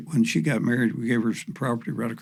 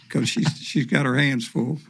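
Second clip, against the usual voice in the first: ordinary delivery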